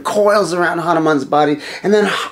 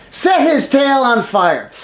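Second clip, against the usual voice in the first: slow, higher, louder cruel order; gloating command; 5 semitones higher, 4 dB louder, slower than usual. Acted. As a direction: savour each word of the punishment. King orders torture.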